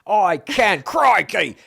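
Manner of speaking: high, loud, hammed-up Aussie exclamation; mock Australian accent. Co-host asks can you believe it, loud mock Australian accent. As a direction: burst out in a playful Australian accent.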